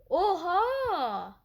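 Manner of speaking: high, surprised "oha" in an odd voice; surprised gasp. Real, not acted. Gamer reacting to a surprise in the match. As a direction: let the surprise jump out.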